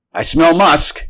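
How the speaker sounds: high, loud alert; announcing. A narrator's reading. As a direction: announce it sharply to the crew. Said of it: "he announced".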